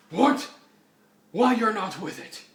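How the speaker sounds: high, loud indignant questioning; incredulous outburst. Acted. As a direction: demand it in hammed-up shock. Bruce reacts in disbelief.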